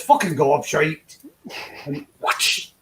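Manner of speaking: slow, drawn-out mock Glaswegian aunt; mock Scottish accent. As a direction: stretch it out like a Scottish auntie telling you off. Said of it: "you know how Russ Abbott used to sound".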